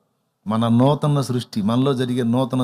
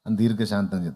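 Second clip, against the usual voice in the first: ordinary delivery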